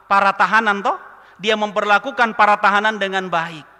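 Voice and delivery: loud, driving sermon delivery; forceful preaching. Real, not acted. Pastor preaching to the church about Julius, loud and forceful. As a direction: preach it hard to the congregation.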